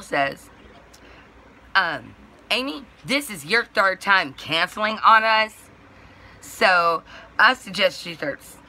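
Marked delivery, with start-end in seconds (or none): 1.7-8.0 raised, higher scolding voice; mildly yelled telling-off, imitating the caller; retold yelling. Dispatch woman scolding a customer for canceling, imitated yelling. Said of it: "like, just yelling at me".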